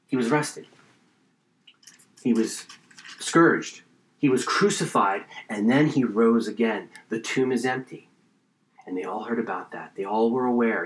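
hushed solemnity. Real, not acted. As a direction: let each event land quietly, with long pauses. Solemnly recounting arrest, crucifixion, empty tomb.

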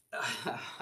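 soft chuckle to himself; real laugh at the coming story; amused laugh. Real, not acted. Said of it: "I'm laughing 'cause of the story here".

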